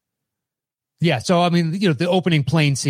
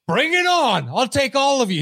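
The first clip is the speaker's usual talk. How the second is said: high-pitched imitation of a boastful bro; mock bravado; 9 semitones higher than usual. Player challenging all comers to shotgun beers, mock bravado. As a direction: throw out a playful challenge, pitched right up. Acting a bragging bro by pool.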